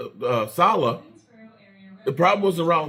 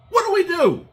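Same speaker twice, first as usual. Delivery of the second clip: high, loud exasperated yell; desperate fan cry; 5 dB louder than usual. Real, not acted. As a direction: throw the question up in disbelief. Repeated, higher and louder.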